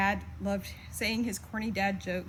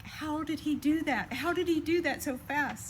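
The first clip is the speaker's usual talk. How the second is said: higher-pitched mock exasperation, imitating mom; frustrated; 7 semitones higher than usual. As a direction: quote her mildly exasperated, pitched up in disbelief. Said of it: "she would be so frustrated".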